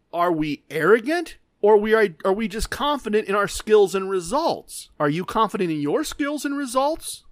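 ordinary talk

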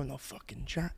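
very soft, barely voiced aside; hushed disbelief. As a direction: say it under your breath. Retelling his doubting aside.

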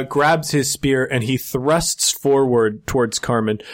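ordinary delivery

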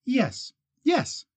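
put-on high hurried young woman; Kate, hasty and high; quick eager agreement. A narrator's reading. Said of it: "She nodded quickly".

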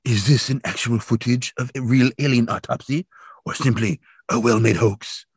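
put-on TV host voice, measured and dramatic; Stupendous Yappy. Stupendous Yappy asking on television, theatrical host voice. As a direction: play a theatrical mystery-show presenter, drawing out the end.

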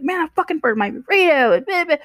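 upset guest griping to his friend, squealed high; hammed-up outraged whine. Angry man explains burrito.

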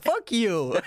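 high laughing troll voice; internet troll. Pretend. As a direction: snap it like an offended troll. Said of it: "The troll voice".

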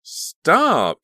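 high loud scream. Acted. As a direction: scream it to stop the fight. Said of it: "Caroline screamed".

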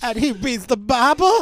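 high, loud incredulous echo; mocking echo. Cohost mockingly repeats Ruth's line, high and incredulous. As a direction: scoff at the Bible claim. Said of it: "repeats line mocking".

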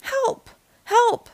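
high little-truck cries; small, high-pitched pleas; crying for help. A narrator's reading. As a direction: call out for help in a tiny high voice. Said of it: "cried the little blue truck".

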